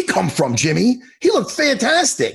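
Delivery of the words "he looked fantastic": high-pitched, gushing hype; excited, high-pitched emphatic praise. Real, not acted. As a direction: rave about the fighter. Hyping up a fighter's performance.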